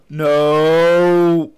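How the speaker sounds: long, loud drawn-out cry of defeat; loud gamer's howl at losing; anguished yell. Real, not acted. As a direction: wail it out in real dismay. Dies and loses everything.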